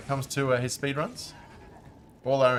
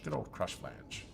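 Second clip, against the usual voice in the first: low, slow, softer mock-fond put-on voice; 5 semitones lower, 9 dB quieter, slower than usual. Streamer greeting a junk item drop with mock affection.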